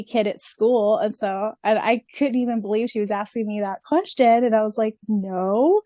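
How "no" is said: ordinary delivery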